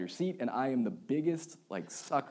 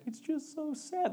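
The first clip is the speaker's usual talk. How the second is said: high, slow, weepy imitation of his wife; mock tearful wife; 3 dB quieter, slower than usual. Pastor imitates his wife crying over This Is Us, high and weepy. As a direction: pitch it way up and stretch it like a sniffling wife. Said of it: "She's like".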